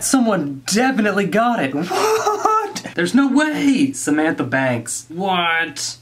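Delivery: can't believe someone guessed it; laughing disbelief. Real, not acted. Shocked a guess is right.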